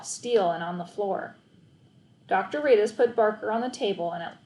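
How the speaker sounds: usual speaking voice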